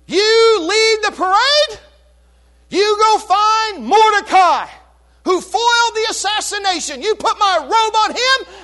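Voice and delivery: royal command. Retold or imitated. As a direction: command him like a king. King commands Haman.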